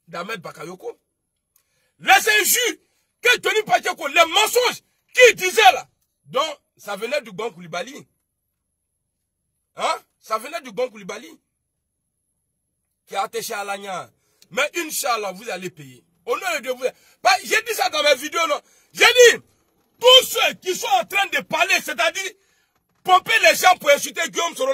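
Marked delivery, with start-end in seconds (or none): 2.0-5.9 loud, high furious outburst, slowing on the last words; angry shout. Real, not acted. Angry rant against insulting rivals. 17.2-24.7 loud, high threatening yell; angry shout. Real, not acted. Warning his enemies on stream.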